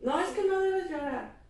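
high-pitched imitation of a parent telling a child off; scolding parent. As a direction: mimic a parent cutting off the crying, a bit over the top. Padre regañando al hijo llorando. (imitando a un padre que regaña, voz aguda)

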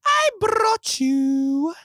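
high, slow, excited reveal; excited singsong. Real, not acted. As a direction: sing out the surprise gift. Presenting a surprise gift.

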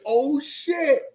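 sudden realization. Retold or imitated. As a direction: it dawns on you all at once.

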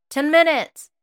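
loud, high impatient shout. Acted. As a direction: bark it back at the door. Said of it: "long enough to shout the two words".

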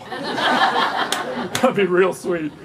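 very slow, laughing sarcastic drawl. Pretend. Long-married listeners mocking the groom's vows, slow sarcastic laugh.

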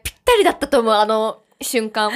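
ordinary delivery

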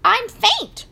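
high, loud squeal; squealing pig. A narrator's reading. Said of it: "squealed Roly Pig".

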